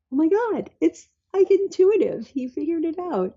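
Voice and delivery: high-pitched, retold astonishment; amazed realization. Mom amazed her son worked out the device, high astonishment. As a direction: marvel aloud, voice jumping high.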